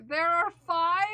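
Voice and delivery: ordinary delivery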